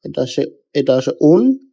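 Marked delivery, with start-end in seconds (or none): 1.2-1.7 "উন" starting straight on the u vowel; demonstrated double-pesh sound. Shown as a demonstration. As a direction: contrast a plain-onset "উন", no y glide. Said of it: "এটার উচ্চারণ আসে এরকম".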